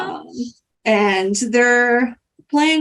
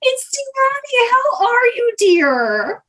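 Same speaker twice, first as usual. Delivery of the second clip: high, drawn-out gushing hello; gushing greeting; 12 semitones higher than usual. Acted. Greta greets Tiani on the beach, gushing. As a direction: greet them in a high sing-song coo.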